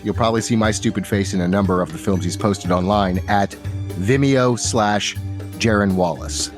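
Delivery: usual speaking voice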